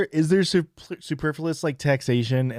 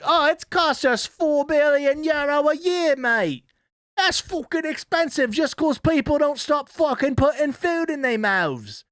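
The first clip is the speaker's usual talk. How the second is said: much higher, hammed-up bloke voice; mock British bloke; 13 semitones higher than usual. Streamer mocking a TV narrator, hammed-up high bloke voice. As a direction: sneer it like a smug taxpayer.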